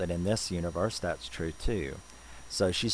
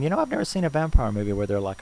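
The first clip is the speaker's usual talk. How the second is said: much higher, louder, rushing fast; excited amazement; 7 semitones higher, 6 dB louder, much faster than usual. Retold or imitated. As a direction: blurt it out, thrilled and racing. Viewer's amazed reaction.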